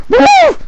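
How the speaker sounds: high loud whoop of fright; startled yelp. Acted. Scouts yelping as the monster wakes. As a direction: yelp like a spooked kid. Boys scream and flee.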